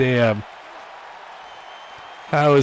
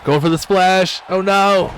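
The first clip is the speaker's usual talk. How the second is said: much higher, louder alarmed call; rising panic, higher and louder; excited; 8 semitones higher, 3 dB louder than usual. Real, not acted. Dreading a finishing move.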